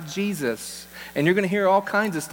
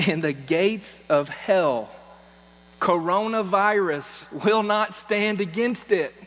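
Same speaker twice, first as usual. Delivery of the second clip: slow defiant proclamation; 3 semitones higher, slower than usual. Real, not acted. Defiant scripture with coronavirus aside.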